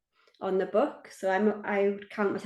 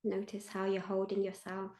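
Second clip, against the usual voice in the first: guided meditation; 3 dB quieter than usual. Acted. Meditation leader invites listeners to feel held, guided meditation. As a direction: lead listeners gently inward, leaving space after each phrase.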